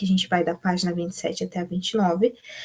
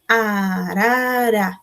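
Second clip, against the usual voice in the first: very slow, every syllable split apart; much slower than usual. Shown as a demonstration. Teacher showing the class three syllables, very slowly. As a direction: break the word into its syllables. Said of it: "Palavrinhas que tem três sílabas quando a gente separa".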